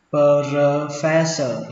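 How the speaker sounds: "professor" slowly broken into pơ-rơ-fe-sơ; syllable by syllable. Shown as a demonstration. Teacher first models the word for learners, slow and syllabified. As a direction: stretch out each syllable of "professor".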